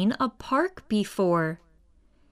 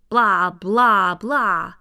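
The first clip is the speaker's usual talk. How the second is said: mock alien babble, blunt and louder; alien gibberish; 5 dB louder, slower than usual. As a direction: blurt silly alien nonsense. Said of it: "the aliens just went".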